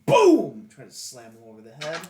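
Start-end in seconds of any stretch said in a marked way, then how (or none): 0.1-0.7 high, loud "boom" sound effect; booming impact. Acted. Player slams mace over the head, booming. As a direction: punch out a big exploding boom. Acts out the mace blow landing.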